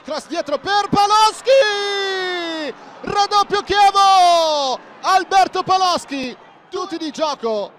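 loud, slow goal shout; roaring goal call. Real, not acted. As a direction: roar out the goal. (urlo del gol)

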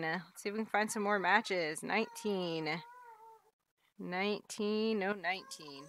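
normal talking voice

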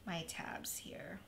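slow, soft whisper. Real, not acted. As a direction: whisper while concentrating. Concentrating, voice drops.

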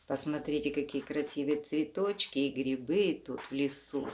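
ordinary delivery

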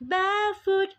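"barefoot" sung out high; high note. Shown as a demonstration. Teacher shows students the note, high sung "barefoot". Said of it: "เสียงสูงนะคะ".

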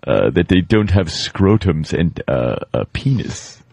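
hint of a fussy actor, mock-puzzled; actor guest, baffled. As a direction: muse in mild stagy bewilderment. Plays baffled actor looking at nudes.

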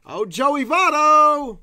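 high, loud, stretched name; excited call. Real, not acted. Calling out a prized card to friends. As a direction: savor the name like a jackpot.

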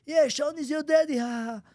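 mock heckler taunt. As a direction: needle him from the stands. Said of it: "he's laughing at me".

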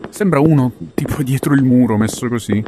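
ordinary delivery

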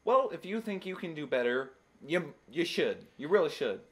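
ordinary talk, nothing marked

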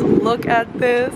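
ordinary talk, nothing marked

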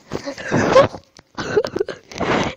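high breathy nervous laugh. Real, not acted. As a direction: laugh off the scare. Nervous reaction.

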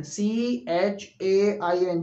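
slow letter-by-letter, सी एच ए आई एन; spelling out letters. Shown as a demonstration. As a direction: spell सी एच ए आई एन out one letter at a time. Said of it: "वो होता है चैन".

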